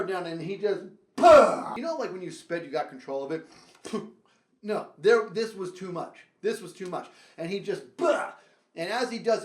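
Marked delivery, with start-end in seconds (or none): 1.1-1.7 big explosive mock spit; loud spit sound. The hero kid spitting, loud spit noise. 8.0-8.3 sharp higher spit noise; mock spit sound. The hero kid spitting, mock spit noise.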